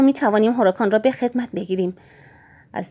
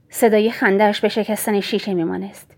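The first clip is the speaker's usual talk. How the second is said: usual speaking voice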